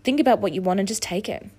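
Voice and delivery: ordinary delivery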